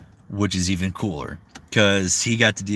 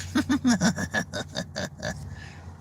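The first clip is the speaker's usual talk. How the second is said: high, whispery cackle; long, breathless giggle trailing away; creepy character laugh; 6 dB quieter than usual. Acted. Laughing in the Moondrop impression.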